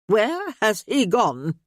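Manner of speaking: put-on slow, imperious demand from an old lady. A narrator's reading. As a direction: insist on an answer, haughty and slow. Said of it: "she demanded".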